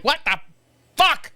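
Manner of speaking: mild mock yell, jumping high at the end; retold yelling. Retelling his phone riff, half-hearted angry yell. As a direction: burst out on the last word. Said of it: "yelling and screaming".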